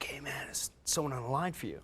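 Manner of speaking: hushed whisper. Retold or imitated. Coworker whispering about a call on the line. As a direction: whisper the news.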